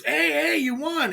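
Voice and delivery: high, bright mock cheer from the crowd; sing-song crowd calling out you won; cheery congratulations. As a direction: imitate everybody cheering him on. People congratulating him at the win.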